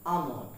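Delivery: Almond with a silent L; correct pronunciation. Shown as a demonstration. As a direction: say Almond as "AH-mond". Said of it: "the pronunciation of this word is almond".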